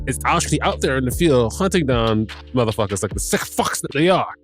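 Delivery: usual speaking voice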